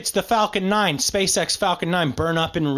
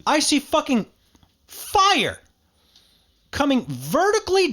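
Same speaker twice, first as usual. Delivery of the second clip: retelling a shocking sight, pitched up; excited; 7 semitones higher than usual. Retold or imitated. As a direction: voice jumping up high on fire. Retelling seeing fire fall.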